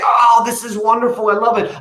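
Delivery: high, loud gushing thanks for a gift; gushing delight. Shown as a demonstration. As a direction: gush over it like you adore it. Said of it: "You say".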